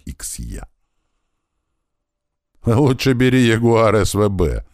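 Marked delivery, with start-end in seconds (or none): 2.7-4.7 higher, louder, lively advice; cheerful. A narrator's reading. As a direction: chime in merrily, voice raised. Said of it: "весело сказал ему маленький". (весело, громко и повыше)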